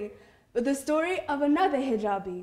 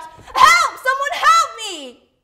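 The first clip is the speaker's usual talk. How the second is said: very high, loud panicked shout; calling for help; 13 semitones higher, 13 dB louder, slower than usual. Acted. Attacked man calling to people around, in a panicked shout. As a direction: cry out desperately to anyone nearby. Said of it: "He then calls out".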